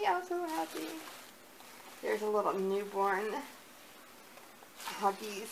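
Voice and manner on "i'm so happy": happy repeat. Real, not acted. Repeating it to the camera, calmer and happy. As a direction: say it again, calmer but glowing.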